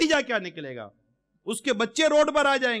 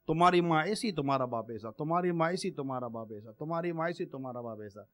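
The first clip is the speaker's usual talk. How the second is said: mock nagging that grows softer with each repeat; repeated taunt fading away; 7 semitones lower, 6 dB quieter than usual. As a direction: nag on and on, then trail off. (بیوی کا طعنہ، آہستہ آہستہ مدھم ہوتا)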